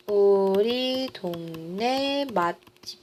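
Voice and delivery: drawn-out syllables at writing speed; slow write-along delivery; slow dictation. Real, not acted. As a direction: stretch each word as you write. Writes out the right example.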